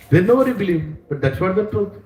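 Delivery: normal talking voice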